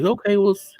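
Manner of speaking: high, courteous but firm dismissal; mock polite grandpa. Grandpa politely orders the strangers to leave, high firm voice. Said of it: "he just stands there".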